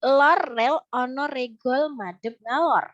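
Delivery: slow, carefully articulated "lor rel ono regol madep ngalor"; articulation demo. Shown as a demonstration. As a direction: take the tongue twister slowly, word by word. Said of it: "kalau cepet nanti jadi belibet".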